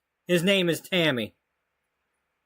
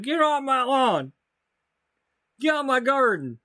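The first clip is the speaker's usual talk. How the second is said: a hint of a cranky old man shouting, higher; mock yelling; 4 semitones higher than usual. Player imagines yelling at kids on the lawn, mock cranky shout. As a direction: yell at the kids off your lawn, half playful. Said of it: "you come out and yell at 'em".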